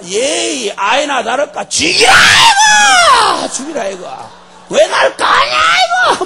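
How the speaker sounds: hammed-up high screaming tantrum; raging Jonah. Jonah railing at God for striking him, screaming mad. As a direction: shout it with long, drawn-out howls. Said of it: "난리가 나서, 난리가 났어".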